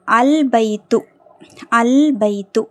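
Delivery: "அல் பைத்து" with a held, audible L; teacher's careful "அல் பைத்து", L fully sounded; lam pronounced. Shown as a demonstration. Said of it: "லாம் உச்சரிக்கப்படுது".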